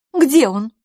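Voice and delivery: high, loud sharp question; demanding. A narrator's reading. Miss LaFosse can contain curiosity no longer, demanding. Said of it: "требовательно сказала она".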